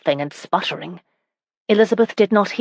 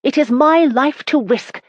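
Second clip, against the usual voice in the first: higher, defiant retort; arguing; 8 semitones higher than usual. A narrator's reading. Wife arguing with her husband about risking her life. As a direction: throw it back at him, higher. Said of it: "Elizabeth argued".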